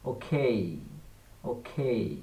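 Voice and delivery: "ওকে" as "ওখেই", puff on the K; "ওকে" as o-khei, breathy K; aspirated খ. Shown as a demonstration. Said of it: "খ দিয়ে হয়েছে".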